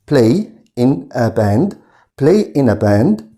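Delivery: slow, clear teacher delivery; slow model phrase. Shown as a demonstration. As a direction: say "Play in a band" slowly so students can repeat. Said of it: "teacher models phrase". (نطق بطيء لـ "Play in a band")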